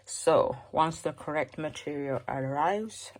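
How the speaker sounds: ordinary talk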